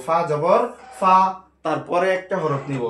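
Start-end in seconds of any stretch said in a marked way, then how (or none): 0.1-1.2 ফা জবর ফা spelled out slowly; slow teacher spelling. Shown as a demonstration. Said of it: "প্রথমে একটা হরফ নিব".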